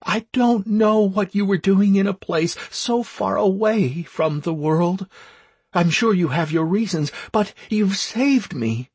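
tired, thankful female; woman's voice. Acted.

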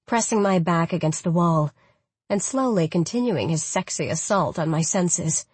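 plain reading voice

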